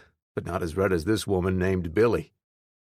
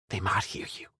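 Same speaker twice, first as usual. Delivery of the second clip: hushed warning; 6 dB quieter than usual. A narrator's reading. Mother warning son quietly.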